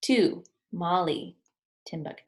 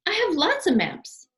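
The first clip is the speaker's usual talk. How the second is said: high, louder fussy voice; Wallace fussy; 12 semitones higher, 6 dB louder than usual. Acted. Wallace telling Albert, high and prim. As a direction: insist primly.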